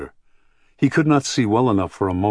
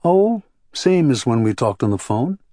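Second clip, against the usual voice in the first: higher-pitched, lightly husky woman's voice; 6 semitones higher, 3 dB louder than usual. A narrator's reading. Woman answers how it's been going, lightly husky voice. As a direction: answer with a touch of husk, pitched up. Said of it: "She said in her husky voice".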